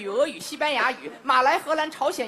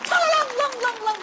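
high, rattling vocal clang; imitated metal clatter; 10 semitones higher, 3 dB louder, much faster than usual. Shown as a demonstration. Storyteller imitating the falling blade, ringing vocal clatter. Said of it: "耳轮中只听得".

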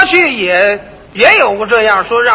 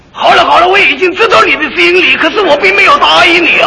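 put-on loud, high, mannered old movie star voice; old-film Mandarin; 4 semitones higher, 6 dB louder than usual. Mocking old Shanghai film dialogue, stagy and loud. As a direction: ham up the stilted film-star guoyu. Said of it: "一说话这味儿".

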